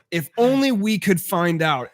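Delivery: slow, dry sarcastic line. Retold or imitated. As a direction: say it slowly, loaded with sarcasm. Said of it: "I was like".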